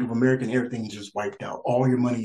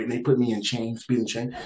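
ordinary talk, nothing marked

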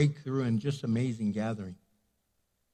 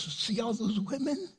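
higher, delighted voice for God; God voiced playfully; 10 semitones higher than usual. God pointing angels to the praising women, high delighted voice. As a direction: boast happily like a proud father. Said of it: "He goes".

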